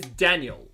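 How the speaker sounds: Daniel as DAN-yul, weak ending; third of three names. Shown as a demonstration. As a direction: stress the first beat of Daniel. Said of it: "there's actually three different names".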